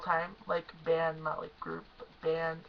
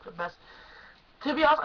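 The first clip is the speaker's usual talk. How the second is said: ordinary talk, nothing marked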